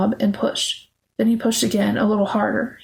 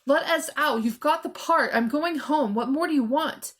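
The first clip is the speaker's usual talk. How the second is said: urgent raised voice; shouting; 4 semitones higher, 5 dB quieter than usual. A narrator's reading. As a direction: shout desperately at whoever locked you in. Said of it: "she shouted".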